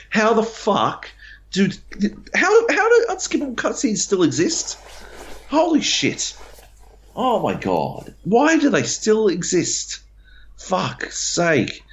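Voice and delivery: fed up with unskippable cutscenes, exasperated; exasperated outburst. Real, not acted. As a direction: throw up your hands in disbelief.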